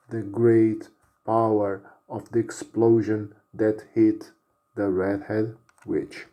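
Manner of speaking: ordinary delivery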